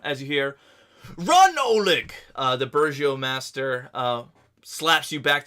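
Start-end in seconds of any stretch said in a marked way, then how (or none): 1.2-2.1 urgent shouted warning, pitched high; loud yell. Acted. Burgomaster to a fleeing villager, loud urgent yell. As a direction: yell it like an alarm down the street. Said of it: "A louder yell breaks all your concentration".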